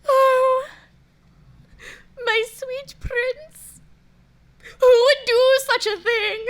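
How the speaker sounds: sobbing, theatrical mourning; weeping lament. Acted. Widow lamenting her murdered prince, weeping. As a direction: mourn aloud through tears.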